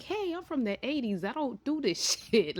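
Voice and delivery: high, scoffing retort about being from the eighties; dismissive mom. Retold or imitated. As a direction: brush it off with a laugh. Mom refusing daughter's VR games.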